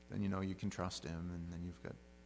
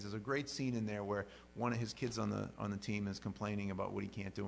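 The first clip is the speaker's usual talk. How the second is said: ordinary delivery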